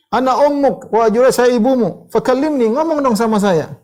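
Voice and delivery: high, loud begging; pleading mother. Retold or imitated. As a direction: beg him to come and talk to you. Mother begging son to speak.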